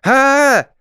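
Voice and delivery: high, loud charging yell; war cry. A narrator's reading. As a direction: roar it as you charge blindly. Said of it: "издавая воинственный клич".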